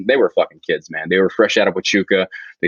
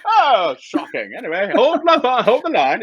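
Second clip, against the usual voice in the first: mock upper-class British officer, much higher and slowed; posh British officer; 13 semitones higher, slower than usual. Mimicking a redcoat officer to the podcast hosts, in a hammed-up British accent. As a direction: ham up a stiff-lipped British redcoat officer, drawn out. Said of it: "redcoats standing out in the field".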